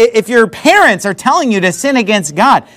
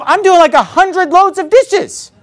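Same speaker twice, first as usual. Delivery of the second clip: mock exasperation; 9 semitones higher, slower than usual. Exasperated thought at dish job.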